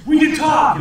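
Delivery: high, loud, triumphant mock cry; mock gleeful shout. Mocking early sound-film actors, gleeful hammed-up shout.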